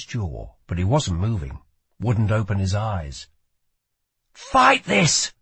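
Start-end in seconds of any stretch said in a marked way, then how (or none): 4.3-5.3 high, forceful shouted command; urgent shout. A narrator's reading. Lila to Kel as his body spasms, shouting. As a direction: shout it high to rouse someone slipping away. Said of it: "she shouted as his body spasmed".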